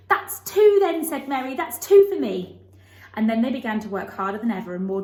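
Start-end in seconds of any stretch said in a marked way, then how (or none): 0.1-2.6 much higher and louder, slow and glad; delighted girl. A narrator's reading. Mary counts Dickon as her friend, delighted girl. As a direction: crow it like a thrilled little girl.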